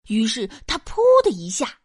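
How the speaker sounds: ordinary delivery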